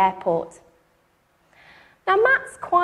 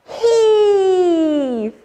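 louder straining heave shout; 5 semitones higher, 6 dB louder than usual. Shown as a demonstration. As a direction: give it everything, even louder. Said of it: "you can do it even louder".

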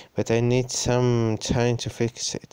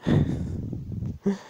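high breathy laugh; 9 semitones higher, 4 dB quieter than usual. Real, not acted. Laughing at the scary story.

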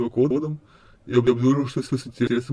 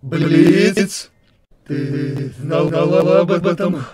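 louder, higher shocked yell; angry outburst; 3 semitones higher, 8 dB louder, much slower than usual. Acted. Error Sans confronts Blitz over the handshake, angry outburst. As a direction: call her out in sudden outrage.

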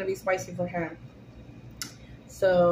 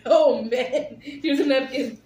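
laughing; 3 semitones higher, slower than usual. Real, not acted. Wife hands her husband a napkin while laughing at his pain. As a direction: talk through real laughter.

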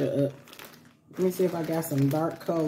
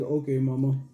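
kid's fake bass; 4 semitones lower than usual. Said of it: "try to put that little bass in his voice".

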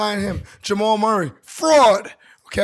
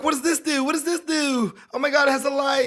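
high, bright excited questions; like kids on Christmas morning; 7 semitones higher than usual. Retold or imitated. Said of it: "We're like".

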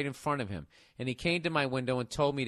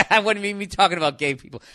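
laughing shout; 5 semitones higher, 9 dB louder, faster than usual. Real, not acted. Host cracking up at his own joke, laughing shout. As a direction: laugh it out loud and fast.

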